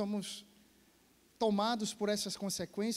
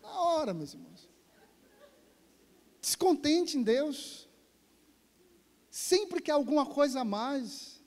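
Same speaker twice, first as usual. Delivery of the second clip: fervent preaching; 5 semitones higher, 3 dB louder than usual. Acted. Preaches with rising force.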